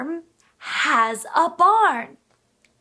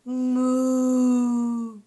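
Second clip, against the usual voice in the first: lower, lips-closed mooing; cow moo; 5 semitones lower than usual. Shown as a demonstration. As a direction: moo deep and round like a cow. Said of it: "Can you moo like a cow?".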